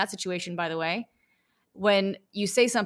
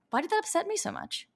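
curious self-question; 11 semitones higher, 4 dB quieter than usual. Retold or imitated. Curious self-inquiry after an insult.

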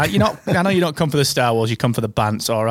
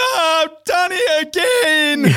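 high, drawn-out wailing cry into the phone; imitated wail; 14 semitones higher, much slower than usual. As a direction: wail it like a man pulling the phone away from his mouth. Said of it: "this wail at the other end".

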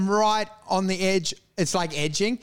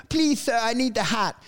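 higher-pitched imitation of begging; mock pleading; 4 semitones higher than usual. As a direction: plead like a desperate street seller. Imitating a pleading street seller.

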